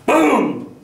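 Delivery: sudden high, booming shout; explosive imitation of a crash; loud boom. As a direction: shout a crash that startles the room. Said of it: "Sorry, that's a little loud".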